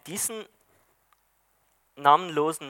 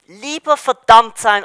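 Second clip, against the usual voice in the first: trotzige Ablehnung; 4 semitones higher, 9 dB louder than usual. A narrator's reading. Schatten lehnt trotzig ab.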